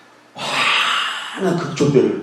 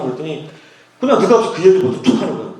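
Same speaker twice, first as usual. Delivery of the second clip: usual speaking voice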